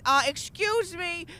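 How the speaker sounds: slow, haughty offended tone; mock snob. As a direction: look down your nose. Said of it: "mock offended snob".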